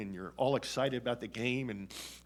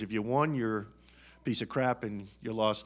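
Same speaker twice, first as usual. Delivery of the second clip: usual speaking voice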